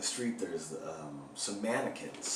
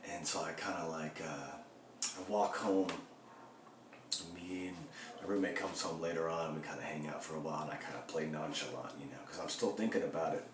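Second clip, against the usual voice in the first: usual speaking voice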